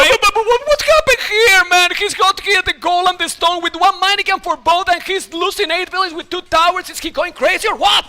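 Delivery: loud, high, stammering disbelief at first; stunned outburst. Real, not acted. Caster questioning the player's crazy choice, stunned shouting. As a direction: shout the commentary in excited bafflement.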